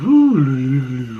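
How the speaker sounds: high, wavering ghost wail; ghostly "ooh". Retold or imitated. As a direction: imitate a ghost swooping over. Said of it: "they're like".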